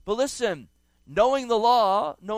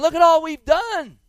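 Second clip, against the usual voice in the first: mock boastful claim; 7 semitones higher, 7 dB louder than usual. Boastful people voiced in sermon.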